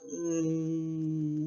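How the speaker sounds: very soft, drawn-out mm; tiny reluctant pre-kiss hum; puckered-up kissing hum. Acted. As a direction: hum through puckered lips. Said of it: "Princess Crunchy puckers up".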